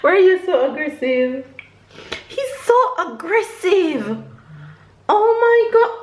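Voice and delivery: high-pitched, playful protest; amused exclaiming. Real, not acted. Mother to her aggressive little one, amused high exclaiming. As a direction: exclaim in mock outrage, voice up high. Baby being rough with mother.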